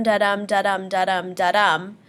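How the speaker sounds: da-dum said weak then strong, steady pulse; even da-dum, da-dum heartbeat; heartbeat rhythm. Shown as a demonstration. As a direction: model the iambic beat for the class. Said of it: "try to follow this da-dum".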